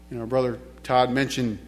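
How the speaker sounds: normal talking voice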